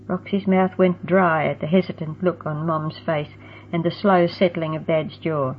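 usual speaking voice

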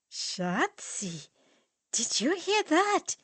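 high, gleeful mocking jab. Dieter to friends, gleefully mocking the pet name. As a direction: crow over the word.

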